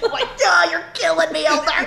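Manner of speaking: high, laughing despair; mock exasperation. Missionary fed up with the elders, laughing exasperation. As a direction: groan it out through laughter.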